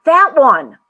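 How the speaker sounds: loud, high, delighted exclamation; emphatic cry of confirmation; exclaimed. A narrator's reading. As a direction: exclaim it with full force. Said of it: "exclaimed the voice".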